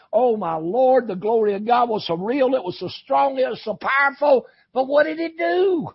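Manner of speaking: high, loud revival preaching; full-force preaching. Acted. Preacher mimicking awed churchgoers then challenging them, full-force preaching. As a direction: build from acted amazement to a loud challenge.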